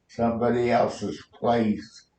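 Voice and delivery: ordinary delivery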